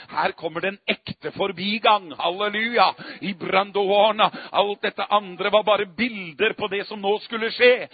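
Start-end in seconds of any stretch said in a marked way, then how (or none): none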